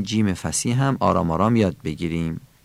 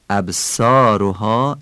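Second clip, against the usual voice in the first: "ابصارها" with a light s and Persian o; Persian accent; much slower than usual. Shown as a demonstration. Teacher shows the tone to avoid, Persian accent. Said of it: "این لحن فارسیه".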